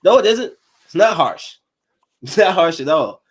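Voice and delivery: loud, high, insistent no; emphatic denial. Real, not acted. Answering parents of girls, loud emphatic denial. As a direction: shut down the critics, loud and high. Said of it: "Oh, that's hard".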